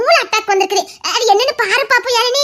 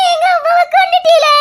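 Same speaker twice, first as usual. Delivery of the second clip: high, loud playful wail; mock accusing shout; 6 semitones higher, 4 dB louder than usual. Daughter jokingly shouts that they killed her mother. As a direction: shout the blame in fun.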